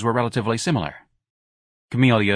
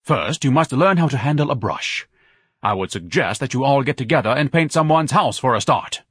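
higher, louder lofty lecture; pompous advice; 5 semitones higher, 3 dB louder than usual. A narrator's reading. Ignatius advises the ladies, pompous. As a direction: instruct them with disdain.

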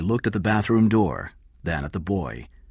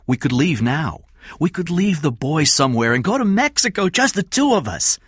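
high, loud, urgent plea; pleading Kathy; 11 semitones higher, 5 dB louder than usual. Acted. Kathy begs Eddie to leave, urgent pleading. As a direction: urge them to run away with you.

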